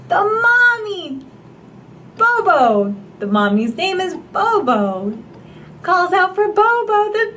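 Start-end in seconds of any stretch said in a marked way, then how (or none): 0.0-2.9 high excited exclamation; thrilled discovery, read to a child; excited cry. Acted. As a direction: gasp it out with delight. Excited discovery in picture book.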